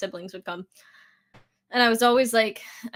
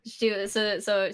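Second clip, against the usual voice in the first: usual speaking voice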